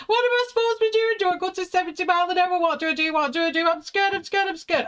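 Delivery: very high, fast panicked questions; frantic fear. Retold or imitated. Merging onto the motorway, squealing fast high panic at husband. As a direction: babble in terror as cars rush past.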